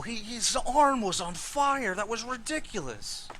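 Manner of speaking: high, fast worried friend; worried friend Jimmy. Acted. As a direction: fret over him anxiously. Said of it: "he's like".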